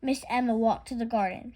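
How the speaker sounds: ordinary delivery, nothing marked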